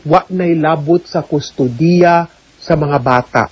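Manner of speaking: ordinary delivery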